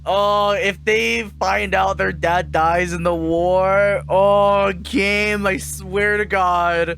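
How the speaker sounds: high, loud and slow, exasperated; real outburst. Real, not acted. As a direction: shout at the game in disbelief. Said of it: "streamer reacting to story twist".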